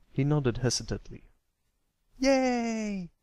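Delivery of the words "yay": high joyful filly cheer; a bright burst of delight, much higher. Acted. Fillies celebrate the colt joining, high happy cheer. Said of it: "The Crusaders cheered in unison".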